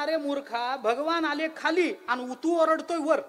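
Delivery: high, drawn-out mock rebuke; mock scolding. Sky telling the foolish cloud off, mock scolding.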